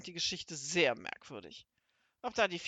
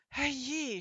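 groaned dismay; 7 semitones higher than usual. A narrator's reading. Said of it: "stöhnte Seppel".

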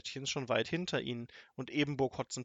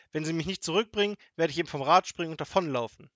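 higher, louder warning; defiant threat; 5 semitones higher, 8 dB louder than usual. A narrator's reading. Grandmother warns the robber she will jump off, defiant. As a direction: threaten him indignantly.

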